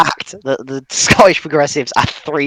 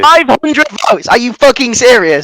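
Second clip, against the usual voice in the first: high outraged yell; furious shout; 8 semitones higher, 5 dB louder than usual. Real, not acted. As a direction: yell in disbelief.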